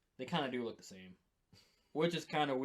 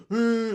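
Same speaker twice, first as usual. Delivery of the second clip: loud, higher beastly roar; Yeti roar; 7 semitones higher, 13 dB louder than usual. Acted. Imitating poked Russian bear for his co-host, Yeti roar. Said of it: "like a Yeti".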